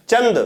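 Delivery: चंद as chand, short vowel and full n; चंद, nasal consonant before the d; nasal-only anusvara. Shown as a demonstration. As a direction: say चंद with a clear n through the nose. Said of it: "यहाँ पे नाक से आ रहा है केवल".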